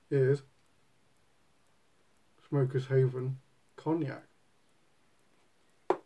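talking around pipe stem; clenched pipe speech; pipe in mouth. Real, not acted. Seen 0: Pipe held in hand until about 2.4s, then clenched in mouth while smoking.

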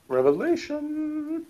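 slow, high, drawn-out through laughter; laughing echo. Real, not acted. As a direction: stretch the word out in mock awe. Echoes word mockingly while laughing.

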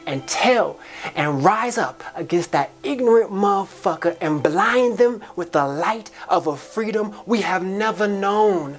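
rousing exhortation. Acted. Charging listeners to tear down the walls, rousing and high-pitched. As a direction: build it like a preacher's charge, pitch climbing.

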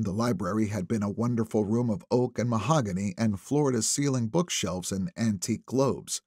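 plain reading voice

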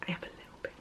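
barely audible whispered aside; whispered confession. Real, not acted. Admitting to viewers she is excited, whispered. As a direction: drop to a secret whisper.

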